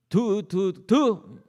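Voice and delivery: light spitting sounds; mock spitting. Mother spits to protect her child, playful. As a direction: imitate a mother spitting to ward off evil.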